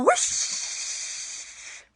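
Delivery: long airy whoosh sound; breathy whoosh. Shown as a demonstration. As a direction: blow it out like wind. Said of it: "And another one".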